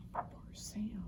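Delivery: soft whisper of pity. Acted. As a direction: whisper it with sympathy. Pity for the sad boy.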